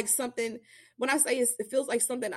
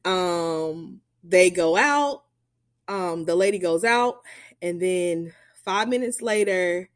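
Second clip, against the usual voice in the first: ordinary delivery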